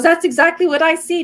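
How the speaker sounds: mock a thrilled healer confirming the vision; excited agreement. Healer confirms she sees the same vision, excited. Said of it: "She goes".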